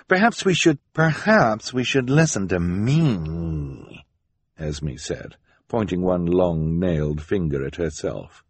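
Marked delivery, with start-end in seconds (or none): 0.1-0.8 high, tentative suggestion, left hanging; hesitant suggestion. Acted. Jerome starts suggesting to his wife, hesitant. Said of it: "Jerome said hesitantly". 0.9-4.1 slow, drawn-out, self-important correction; imperious interruption. Acted. Esme overrules her hesitant husband, imperious.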